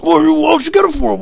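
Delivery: very high, loud mock boss voice; hammed-up boss yelling an order. Boss pushing his driver to obey, imitated as a high yell. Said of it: "my boss was like".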